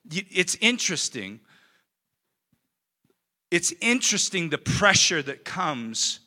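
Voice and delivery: normal talking voice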